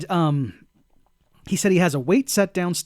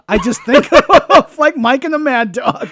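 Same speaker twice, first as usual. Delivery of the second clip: laughing; 7 semitones higher, 8 dB louder than usual. Real, not acted. Laughing at memory.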